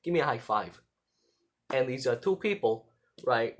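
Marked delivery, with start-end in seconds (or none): 0.0-0.8 hammed-up New York-esque buddy accent; Joey Wheeler. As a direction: pester a pal in a cartoon New Jersey accent. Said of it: "I go around doing my best Joey impression".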